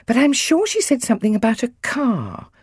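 high, puzzled fretting; mildly worried mum, pitched well up; anxious mum. A narrator's reading. Mum puzzling over who gave the car, mildly anxious. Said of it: "She stared at the list anxiously".

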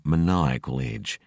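usual speaking voice

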